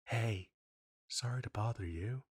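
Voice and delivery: ordinary talk, nothing marked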